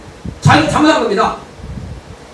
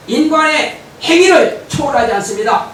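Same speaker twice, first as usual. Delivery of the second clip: usual speaking voice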